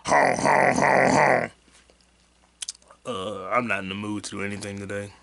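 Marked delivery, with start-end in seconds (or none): 0.0-1.5 high, loud mock honks; playful honk honk; honking like a car horn. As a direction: beep it out like a horn.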